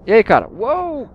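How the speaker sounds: loud call then high yelp of surprise; startled shout. Real, not acted. Gamer hit by a surprise arrow, startled shout. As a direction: jump as an arrow flies at you.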